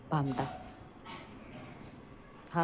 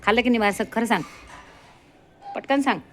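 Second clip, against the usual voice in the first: loud, higher playful demand; mock interrogation; 5 semitones higher, 6 dB louder, faster than usual. Real, not acted. Owner playfully demanding her dog confess. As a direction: press the dog to confess, loudly.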